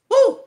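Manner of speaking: high, loud whooping cry; a loud high woo of disbelief; excited whoop. Real, not acted. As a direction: let out a sudden mocking whoop. Mocking the women's thirst.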